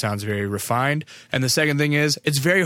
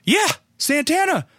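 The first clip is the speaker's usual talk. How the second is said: very high, excited dad imitation; retold gleeful confirmation; thrilled agreement; 3 dB louder than usual. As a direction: mimic his delight, pitched way up.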